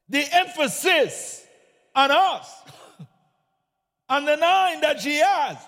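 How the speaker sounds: fiery preacher building to a shout; preaching at full force. Acted. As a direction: drive it home to the congregation.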